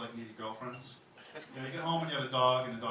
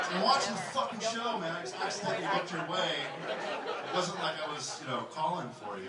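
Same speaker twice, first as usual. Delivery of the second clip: irritated guy; 6 semitones higher, 4 dB louder than usual. Acted. Owner's irritated retort.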